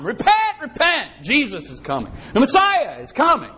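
a hint of a wandering preacher's call; street preacher. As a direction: cry it down the road. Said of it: "preaching the baptism of John".

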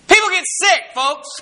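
emphatic preaching. Acted. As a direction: call it out to the congregation. Preacher rebuts faith-healing claims.

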